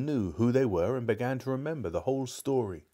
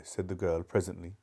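plain reading voice

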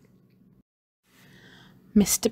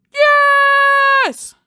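high, loud shouted yes; crowd cheer; 10 dB louder than usual. A narrator's reading. Pupils cheer back at their leader, shouting.

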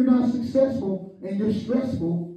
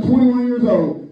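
usual speaking voice

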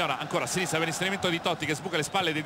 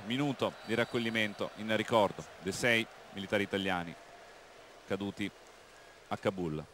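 hushed solemn; 9 semitones lower, 8 dB quieter than usual. Real, not acted. Solemn minute of silence announcement.

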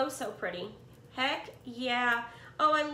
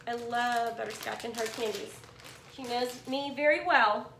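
ordinary talk, nothing marked

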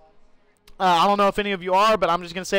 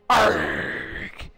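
long growled pirate "arrr"; pirate arrgh. Pretend. Streamer declaring himself a pirate, mock pirate arrgh. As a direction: draw out a hammed-up pirate growl. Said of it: "I'm a goddamn pirate now".

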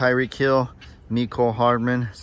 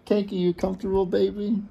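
pet talk; 9 semitones higher than usual. Real, not acted. Cooing at a sleepy cat.

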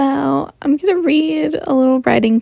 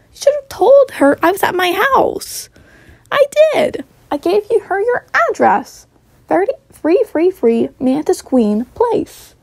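much higher, louder bickering; heated phone quarrel; 9 semitones higher, 3 dB louder than usual. Acted. Arguing over the phone about the address, higher bickering. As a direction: argue it back fast.

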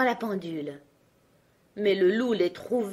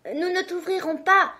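put-on much higher, loud kids' shout; kids shouting; 10 semitones higher, 3 dB louder than usual. A narrator's reading. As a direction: shout it through the door like frightened kids. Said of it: "crièrent-ils". (les chevreaux crient, très aigu)

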